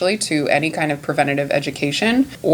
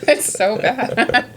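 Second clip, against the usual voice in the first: slow, laughing at herself; 8 semitones higher, slower than usual. Real, not acted. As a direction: giggle through an embarrassed admission. Laughing at own mispronunciation.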